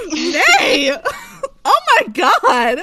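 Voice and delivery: high, loud, laughing shock; scandalized squeal. Real, not acted. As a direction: shriek through laughter, delightedly shocked. Scandalized by the shirt scene.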